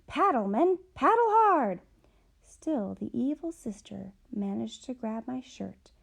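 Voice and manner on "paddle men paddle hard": much higher and louder shout; Day Day re-enacts shouting to his men; urgent shout. Retold or imitated. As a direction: yell to the men to row for their lives. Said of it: "I shouted to my men".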